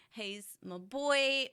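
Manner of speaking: normal talking voice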